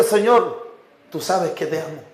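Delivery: a subdued, near-tearful confession, acted lightly; almost crying. Peter answering the Lord's question, almost crying, retold softly. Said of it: "casi llorando Pedro le decía".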